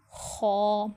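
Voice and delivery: ordinary delivery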